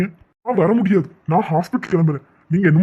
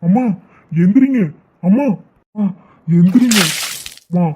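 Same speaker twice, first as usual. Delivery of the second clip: higher, frantic calls to wake mother; panicked calling; 4 semitones higher than usual. Acted. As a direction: shake her awake in alarm. Son finds collapsed mother. (மயங்கிய அம்மாவை எழுப்ப பதறும் மகன்)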